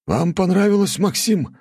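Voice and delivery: lifted, eager foreign guests; two men asking together, high; high chorus voice. A narrator's reading. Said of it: "хором спросили Джабар с Шапуром".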